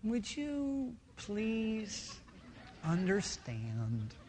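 a pastor off the pulpit, asking softly; soft, gentle ask. Retold or imitated. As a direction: ease right down as if sharing a secret. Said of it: "when you see me away from the pulpit".

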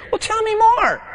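hammed-up high coaxing; pushy friend. Acted undersharing friend dialogue.

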